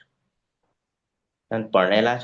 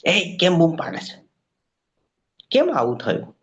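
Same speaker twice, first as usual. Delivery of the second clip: scolding beggars; 7 semitones higher than usual. Retold or imitated. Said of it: "એ લોકો એને ધમકાવ્યો".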